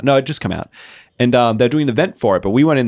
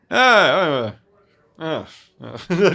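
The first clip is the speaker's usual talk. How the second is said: higher, drawn-out mock drowsy groan; imitated sleepy groan; 6 semitones higher, much slower than usual. Drunk stranger trying to sleep in the store, sleepy groaning. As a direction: groan like someone settling down to sleep. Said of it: "Just tries to just sleep".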